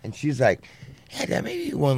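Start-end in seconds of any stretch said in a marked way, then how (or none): 1.1-2.0 much higher daughter's voice; lightly voiced daughter. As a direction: play his daughter guessing.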